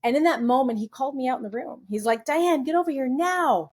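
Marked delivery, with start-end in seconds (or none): 2.2-3.7 higher-pitched mild mock yell; retold yell. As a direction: imitate him yelling an order, without full volume. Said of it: "But he yelled it".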